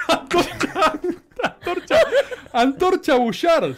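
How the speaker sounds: slow, laughing through the nickname; high laughing repeat of the name. Real, not acted. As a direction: giggle out each word.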